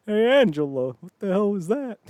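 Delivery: high-pitched startled sitcom voice; mock alarmed question; sitcom dad reaction. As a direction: call out, baffled, pitch rising. Acted Who's the Boss character reacting.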